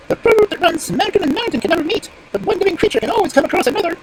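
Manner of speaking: very high squeaky bird voice; high-pitched bird reciting; proverb-quoting stork. Acted.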